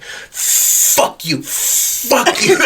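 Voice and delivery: lightly voiced tic blurts through laughter; half-whispered mock swearing, climbing up; imitating tic outburst. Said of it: "She'd be like".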